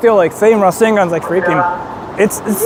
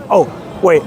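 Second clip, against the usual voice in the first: ordinary delivery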